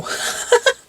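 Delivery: high breathy burst of laughter; startled pained laugh. Real, not acted. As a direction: laugh off the sting. Laughs after wax burn.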